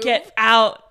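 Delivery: loud, sudden get-out; shout. Pretend. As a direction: bark it at the crowd. Shooing neighbors out of bathroom.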